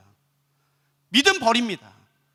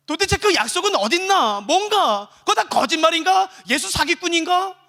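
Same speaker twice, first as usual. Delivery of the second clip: high, pressing doubtful questions; conflicted questioning; 5 semitones higher than usual. Acted. Believers doubting the promise of Jesus, insistently questioning. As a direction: fire off doubts, one after another. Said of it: "그 수많은 갈등과 질문을".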